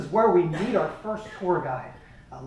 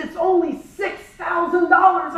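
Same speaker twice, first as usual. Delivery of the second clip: high, slow sweet sales voice; travel agent; 10 semitones higher, 5 dB louder, slower than usual. Retold or imitated. Travel agent telling the couple the price, high and sweet. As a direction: drop the price sweetly and slowly.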